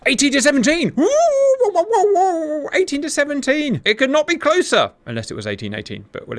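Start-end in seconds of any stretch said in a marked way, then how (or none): 0.1-4.9 high-pitched excited commentary with whoops; thrilled whooping. Real, not acted. Commentator thrilled at a battle that couldn't be closer, whooping. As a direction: whoop and cry out the score. Whoops at one-point score gap.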